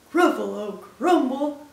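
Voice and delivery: loud, aghast repeat; alarmed Gruffalo. Acted. Gruffalo hears it will be eaten, alarmed. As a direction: echo it in hammed-up horror.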